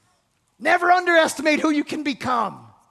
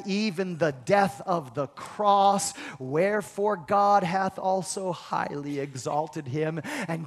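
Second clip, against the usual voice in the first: lower, softer solemn reading; scripture reading; 6 semitones lower, 4 dB quieter than usual. Real, not acted. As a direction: read it reverently like holy text. Reads scripture passage.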